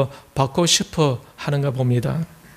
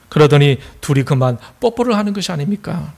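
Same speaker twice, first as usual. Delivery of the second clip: ordinary talk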